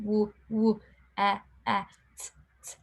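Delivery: mimicking a child sounding out each letter sound. Teacher imitating a boy sounding out a word. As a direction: break the word into halting single sounds. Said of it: "you can actually see his little brain doing".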